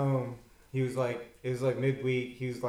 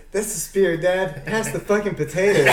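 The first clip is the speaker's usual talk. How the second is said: higher and louder, laughing through it; laughing punchline; 6 semitones higher, 8 dB louder than usual. Acted. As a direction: deliver the son's line cracking up.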